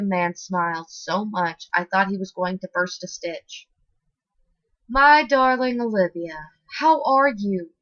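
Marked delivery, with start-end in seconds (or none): 4.9-7.7 delighted greeting. Acted. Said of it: "like Olivia was a friend he hadn't seen in forever".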